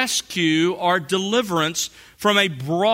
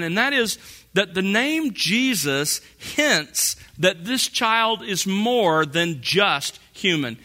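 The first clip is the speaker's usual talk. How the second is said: ordinary talk, nothing marked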